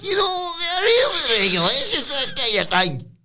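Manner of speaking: high, cry-like put-on whine; mock whiny protest. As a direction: whine it up high like a fussy rule-keeper. Imitating Noam whining about rules.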